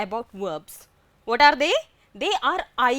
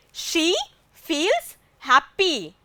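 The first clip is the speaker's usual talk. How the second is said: slow, spaced-out example sentence; slow repetition; 4 semitones higher, slower than usual. Shown as a demonstration. Teacher repeating an example for the class, slow and deliberate. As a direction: say it slowly, word by word.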